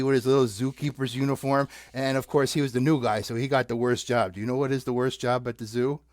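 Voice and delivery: ordinary delivery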